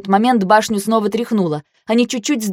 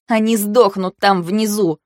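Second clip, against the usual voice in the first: ordinary delivery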